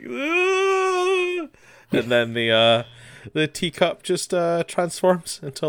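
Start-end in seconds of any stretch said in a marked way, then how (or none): 0.1-1.5 long, sustained high-pitched "aah"; high squeal. Retold or imitated. He gets all blushed faced over the tea, squealing. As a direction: imitate a flustered squeal, held on one note. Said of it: "just goes like".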